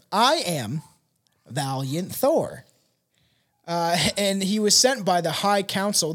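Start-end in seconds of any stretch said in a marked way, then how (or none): 0.1-2.6 slow, calm spaceman voice; a hint of a solemn alien. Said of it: "he calmly identified himself as Valiant Thor".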